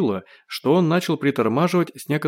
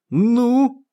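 high, loud burst of joy; light up on one drawn word; delighted; 12 semitones higher, 5 dB louder than usual. A narrator's reading. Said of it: "обрадовался Родионов". (радостно)

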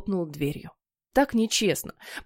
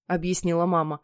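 plain reading voice

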